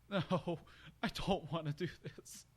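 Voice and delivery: high, laughing groan; laughing reluctance. Real, not acted. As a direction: laugh off the dread. Reader dreading last part.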